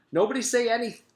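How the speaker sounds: put-on high, loud girl's warning; loud urgent girl's warning cut off. A narrator's reading. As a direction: warn the class in a panic. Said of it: "Jenny tried to warn".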